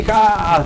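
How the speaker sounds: a single sharp high ha; mock burst of laughter. Teacher mimicking a listener who bursts out laughing. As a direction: act out the listener cracking up at the joke.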